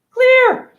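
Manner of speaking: emphatic high shout. Real, not acted. Emphatic point in a rant.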